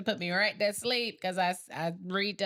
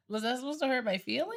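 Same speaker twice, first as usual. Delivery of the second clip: normal talking voice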